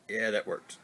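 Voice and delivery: ordinary talk, nothing marked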